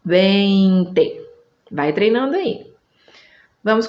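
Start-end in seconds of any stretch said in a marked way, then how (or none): 0.1-1.2 "vinte" stretched as Spanish VEEEIN-te; drawn-out E gliding into I in "vinte", VEIN-te; correct Spanish pronunciation. Shown as a demonstration. Teacher drilling learners on E then I, drawn-out correct pronunciation. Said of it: "E I".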